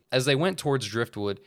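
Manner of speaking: usual speaking voice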